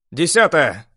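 loud urgent call; loud pilot call. A narrator's reading. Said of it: "довольно громкий голос пилота".

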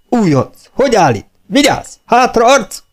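high, barked drill orders; sergeant's shouted drill; military commands. A narrator's reading. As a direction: snap out orders at the recruit, pitch soaring.

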